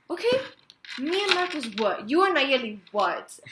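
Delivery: high, loud confronting; angry retort. Retold or imitated. Confronting the boyfriend about Nayeli, loud and heated. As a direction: throw it back at him. I got hella pissed off.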